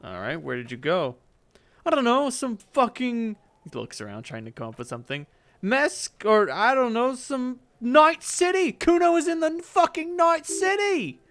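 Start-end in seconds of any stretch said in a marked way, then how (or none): 1.9-3.4 high, slow bratty stalling; a kid making it up as he goes; bratty kid Kuno. Acted. Kid fumbles an alibi, high and slow. 5.6-11.2 put-on high, slow kid voice inventing a city; proud kid pulling a name from nowhere; bratty kid Kuno. Kid brags about Night City, high and proud.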